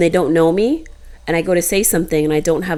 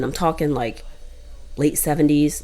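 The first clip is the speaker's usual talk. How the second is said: ordinary talk, nothing marked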